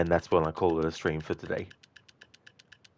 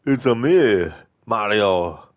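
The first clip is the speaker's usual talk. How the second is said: put-on gruff Arnie-accented Mario, louder; Arnie-style Mario; 3 semitones higher, 8 dB louder, slower than usual. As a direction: growl the Mario line like Schwarzenegger. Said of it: "like, an Arnie accent that".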